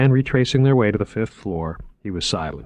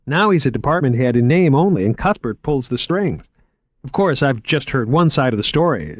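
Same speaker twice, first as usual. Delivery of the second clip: higher, keen salesman voice; lightly raised, enthusiastic museum man; eager curator Moriarty; 6 semitones higher, 4 dB louder than usual. Acted. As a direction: pitch the exhibit like an excited young curator. Moriarty explaining.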